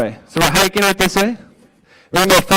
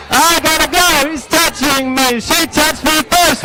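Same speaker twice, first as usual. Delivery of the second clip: put-on whiny kid; 7 semitones higher than usual. Leading the kids' whiny chant.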